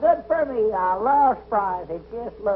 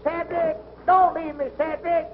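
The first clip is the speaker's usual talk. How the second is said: high, anxious call after someone leaving; pleading call; 6 semitones higher than usual. Acted. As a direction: plead not to be abandoned. Tied man left on tracks.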